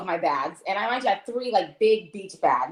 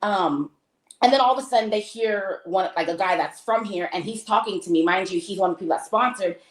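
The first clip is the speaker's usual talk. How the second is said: ordinary talk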